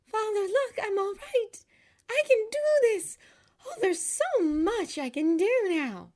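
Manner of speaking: high, loud, jubilant exclamations; happy cry. A narrator's reading. Girl showing her father she can stand, happy cry. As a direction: exclaim it high, brimming with joy. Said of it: "she cried happily".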